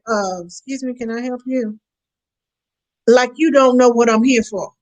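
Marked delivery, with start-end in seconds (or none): 0.1-1.8 soft, cold, suspicious clerk's question; mock the clerk who eyes you warily; snooty clerk. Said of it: "and like".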